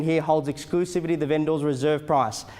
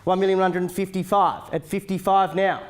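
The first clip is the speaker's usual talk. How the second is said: auctioneer calling bids; 3 semitones higher, 3 dB louder than usual. Acted. Live auction bidding calls.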